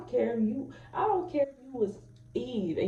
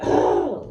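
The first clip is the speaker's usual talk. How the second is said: loud, voiceless burst of exasperation; mock frustrated outburst; 5 semitones higher, 12 dB louder than usual. Parent at the end of their patience, mock exasperated outburst. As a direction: act out a sudden loud, breath-only "oh". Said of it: "You don't need to scream".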